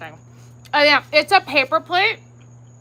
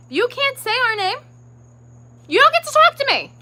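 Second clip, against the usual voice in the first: higher and louder, fed up; rising indignant outburst; 10 semitones higher, 5 dB louder than usual. Real, not acted. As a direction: build to an outraged cry.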